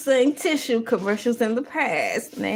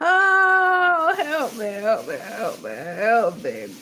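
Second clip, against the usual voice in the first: mock cry for help; slower than usual. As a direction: wail for help like a melodrama.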